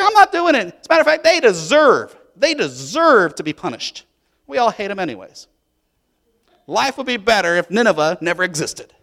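high loud stubborn outburst; defiant refusal. Acted. Jonah refusing God's command, loud defiant outburst. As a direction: dig in your heels and refuse loudly. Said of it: "It's like".

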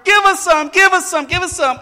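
high, loud repeated begging; imitating clamoring children, insistent; bombarding brothers. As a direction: pester like a pack of kids. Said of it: "They started bombarding her".

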